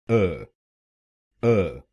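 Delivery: अ as a short neutral schwa ə; just a light schwa अ; third syllable alone. Shown as a demonstration. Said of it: "तीसरे शब्दांश को बोलिए".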